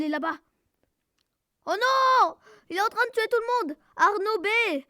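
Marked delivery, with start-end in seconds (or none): 1.7-4.8 high, loud panicked cry; frantic raised voice; alarmed shouting. Real, not acted. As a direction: yell the bad news to the team. Alarm as teammates get killed.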